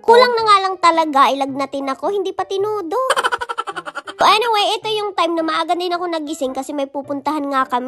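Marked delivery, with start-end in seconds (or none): 3.0-4.2 a short giggle at her own joke; light laugh. Real, not acted. Vlogger laughing at her own joke, light giggle. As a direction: laugh it off softly.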